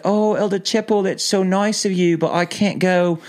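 imitated Kiwi woman, pitched high; thick New Zealand accent. Sister missionary politely declining an invitation, thick New Zealand accent. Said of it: "she had a really thick, thick accent".

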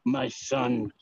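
lightly acted, low and grave; hurt Zeus. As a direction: drop low for a mock-solemn father. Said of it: "you saw the tonal change, though, when he was hurt".

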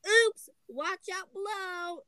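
high, drawn-out warning call; slow storybook call to those below. A narrator's reading. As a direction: sing out the warning, long and high. Froggy calling down after dropped hammer.

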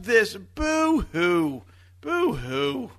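mock boo-hoo sobbing; fake crying. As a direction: whimper it like a mocking crybaby. Mocking whiny fans.

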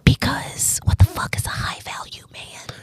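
hushed whisper with a rise on fuck. Shown as a demonstration. Scrolling YouTube and meeting the term, hushed incredulous whisper. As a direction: breathe it out in disbelief. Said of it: "And I say it like this".

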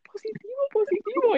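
high excited whisper. Retold or imitated. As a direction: whisper it high across the room. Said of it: "me hacen".

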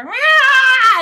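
high shouted cat yelp; yelping meow. Pretend. Player planning a distraction, loud yelping meow. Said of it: "a yelp and go".

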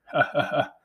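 forceful, slightly menacing laugh. A narrator's reading. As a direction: laugh like a smug villain. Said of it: "The laugh was forceful and a bit menacing".

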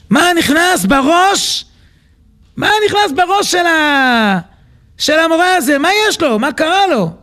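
high, drawn-out bewildered questioning; baffled exasperation. Acted. Sleepless king wonders what got into the teacher, baffled. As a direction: stretch the words out in exasperated disbelief.

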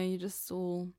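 understated sad speaking voice. Shown as a demonstration. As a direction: let it sink a little, as if sad. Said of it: "When someone feels sad".

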